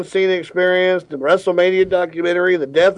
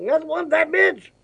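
Samuel L. Jackson voice; 13 semitones higher, 3 dB quieter than usual. Pretend. Jackson beer ad impression.

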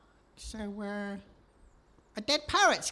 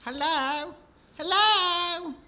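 louder, higher hello; a second, louder call; 4 semitones higher, 5 dB louder, much slower than usual. Acted. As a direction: call out across the deck. Calling out to the ship.

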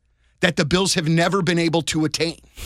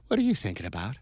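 put-on girl voice; 6 semitones lower, 8 dB quieter, faster than usual. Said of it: "in the girl voice".

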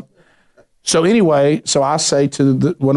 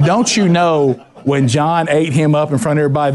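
ordinary delivery

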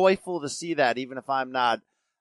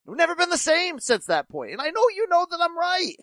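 high-pitched insistence; 14 semitones higher than usual. Real, not acted. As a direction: pitch way up, pleading to be believed. Peak of the insistent rant.

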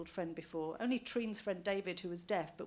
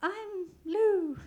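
tremulous; 11 semitones higher, 9 dB louder than usual. Acted. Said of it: "uncharacteristically tremulous".

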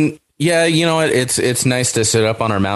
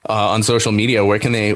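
ordinary talk

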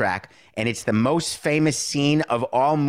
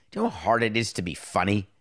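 ordinary talk, nothing marked

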